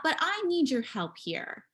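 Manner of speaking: ordinary talk, nothing marked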